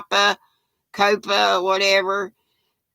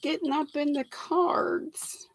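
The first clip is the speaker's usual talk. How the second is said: unvoiced hushed whisper, a little higher; 7 semitones higher, 6 dB quieter than usual. Real, not acted. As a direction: breathe it out in a giggly whisper. Laughing live chat aside.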